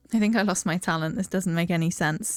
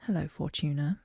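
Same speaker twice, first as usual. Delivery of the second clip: low calm character voice; 5 semitones lower, 6 dB quieter than usual. Acted. Character voice in game.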